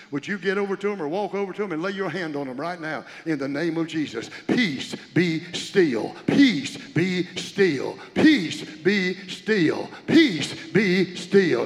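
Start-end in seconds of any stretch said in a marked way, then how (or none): 4.5-11.7 slow, drawn-out, loud declaration; preached proclamation. Acted. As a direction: command the storm to be still. Repeated proclamation over congregation.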